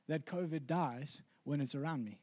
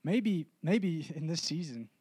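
ordinary talk, nothing marked

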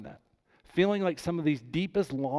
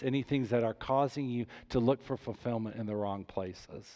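normal talking voice

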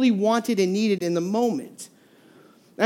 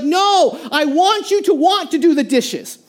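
higher, mock exasperated yell; yelling wife; 6 semitones higher than usual. Wife yelling at husband over dishes, exasperated. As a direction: call it out like a fed-up wife. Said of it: "she just yells out".

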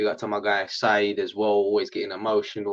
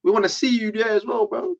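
loud and higher, fast at first; fired-up shouting; 9 semitones higher, 8 dB louder than usual. Real, not acted. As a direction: call the chat out, loud and high. Fired-up call-out to the chat.

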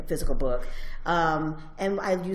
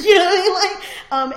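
bursts out laughing, high and loud; real laugh while saying it to the interviewer; 12 semitones higher, 14 dB louder than usual. Real, not acted. Laughing with delight to the interviewer about meeting other Asians.

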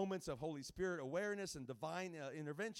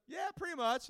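high, loud offhand agreement; mock Jeff; 9 semitones higher, 6 dB louder than usual. Guest preacher quoting the pastor's cheeky reply, high and loud. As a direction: toss it off lightly as a friend would. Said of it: "Jeff said".

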